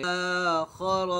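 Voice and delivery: आदम with the first आ held long; slowly drawn out. Shown as a demonstration. Teacher drawing out आदम for the class. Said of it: "खींचकर पढ़ना है".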